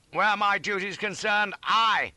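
high, loud avowal of duty; declamatory cry. A narrator's reading. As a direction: proclaim it grandly. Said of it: "cries I, in a fine".

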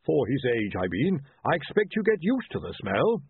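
a touch of held breath; trying not to breathe. A narrator's reading. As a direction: get it out without breathing in. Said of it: "while trying not to breathe".

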